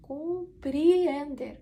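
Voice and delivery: slow teaching pronunciation of Compreender; slow, stretched demo. Shown as a demonstration. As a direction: stretch Compreender syllable by syllable, first E as i. Slow repeat of example word.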